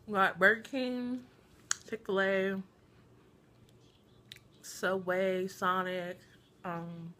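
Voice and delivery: speaking between bites; talking while chewing. Real, not acted. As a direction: mouth full of food. Seen 1: Holds burger, bites at 8.7-9.5, chews with closed mouth between phrases.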